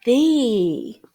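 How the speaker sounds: thee said clearly, falling; modeling word. Shown as a demonstration. Teacher introducing sight word, clear model. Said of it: "What about the word".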